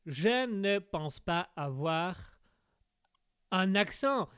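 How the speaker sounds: slow, separated words, "Je ne pense pas"; careful accentless demo. Shown as a demonstration. Québécois guest tries to prove he has none, "un accent" carefully spaced. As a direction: enunciate "pas avoir un accent" word by word, with pauses. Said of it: "écoute, check ça".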